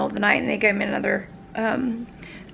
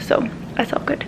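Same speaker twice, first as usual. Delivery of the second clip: whisper; 3 semitones higher than usual. Real, not acted. Unvoiced aside.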